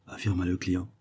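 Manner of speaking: ordinary delivery, nothing marked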